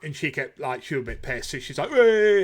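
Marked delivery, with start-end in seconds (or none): 1.9-2.4 put-on loud, high drunken "Hey"; a friend's loud tipsy hail over the band; drunk shout. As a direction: call out like a tipsy friend at a gig. Drunk friend calling out at gig.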